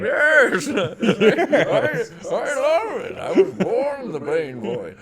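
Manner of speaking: high, loud, slow villain voice; Bane voice. Shown as a demonstration. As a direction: play Bane big and slow. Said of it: "you're good on the Bane".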